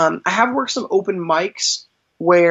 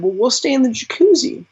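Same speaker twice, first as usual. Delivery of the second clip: lightly voiced kids, pitched higher; a hint of children answering, higher; imitated kids' reply; 6 semitones higher than usual. Kids turning down the magician's offer, lightly imitated higher voice.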